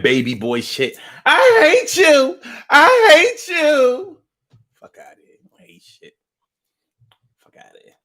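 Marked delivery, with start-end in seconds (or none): 1.2-4.2 high, loud, very slow whine; whiny girlfriend. Retold or imitated. Girlfriend mad at boyfriend, drawn-out high whine. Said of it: "girlfriends get mad at boyfriends". 4.8-7.9 whispered under breath; muttered dismissal. Real, not acted. Dismissing the girlfriend complaint, whispered aside.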